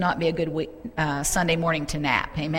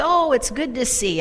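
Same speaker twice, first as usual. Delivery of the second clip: higher-pitched welcoming greeter; acted welcome; 6 semitones higher than usual. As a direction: greet her as she reaches the platform. Heavenly greeter welcoming a woman.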